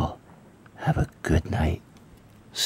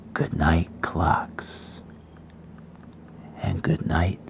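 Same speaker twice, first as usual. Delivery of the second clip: bedtime story reading; 5 dB quieter than usual. Acted. Reads bedtime story to viewers.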